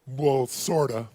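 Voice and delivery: put-on reluctant teen. Girl answers reluctantly.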